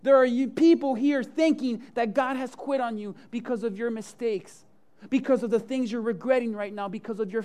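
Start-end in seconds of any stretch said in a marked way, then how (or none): 0.0-6.0 loud, high preaching voice; preaching at full force. Acted. Pastor preaching to his congregation, loud and high. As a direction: preach it out to the crowd.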